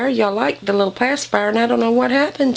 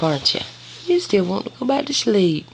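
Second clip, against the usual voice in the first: ordinary delivery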